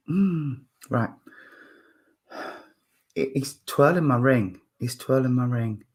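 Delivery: ordinary talk, nothing marked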